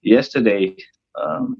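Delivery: normal talking voice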